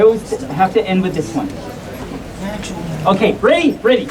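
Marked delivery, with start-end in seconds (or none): none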